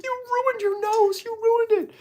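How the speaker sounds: hammed-up furious mom; imitating angry mom. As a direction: wail it like a mother upset with her son. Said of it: "my mom was so pissed at me".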